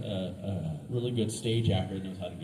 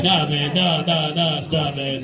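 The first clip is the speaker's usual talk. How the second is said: mock panicked refusal; 5 semitones higher, 6 dB louder, slower than usual. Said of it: "He's like".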